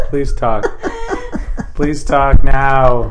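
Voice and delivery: laughing plea. Real, not acted. Begging through laughter.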